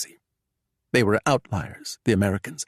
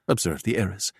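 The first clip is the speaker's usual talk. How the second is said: usual speaking voice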